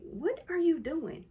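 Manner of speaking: high, drawn-out incredulous question; baffled husband. Retold or imitated. Wife imitates husband finding her on the floor, baffled.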